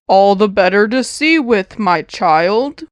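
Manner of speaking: low, slow coaxing wolf; a wolf coaxing the girl into bed; the wolf hiding under the bedclothes, low and coaxing; luring wolf. A narrator's reading. Wolf voiced in story.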